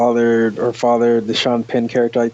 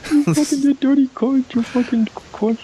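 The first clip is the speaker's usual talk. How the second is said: mock British road rage; 13 semitones higher than usual. Joining in imitating British drivers cursing, high mock rage. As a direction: rant like an angry British driver. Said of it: "montage of British people cursing".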